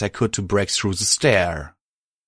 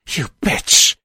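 snarl; 5 semitones higher, 5 dB louder than usual. Acted. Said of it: "Cruelty snarled".

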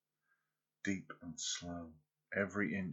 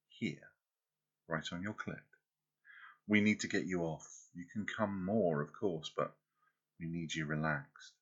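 ordinary talk, nothing marked

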